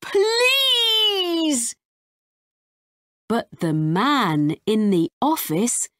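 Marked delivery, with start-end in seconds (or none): 0.0-1.8 high, crying plea; desperate plea. Acted. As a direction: beg with everything. Ben pleading.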